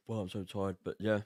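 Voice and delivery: usual speaking voice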